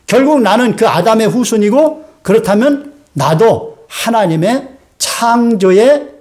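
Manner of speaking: normal talking voice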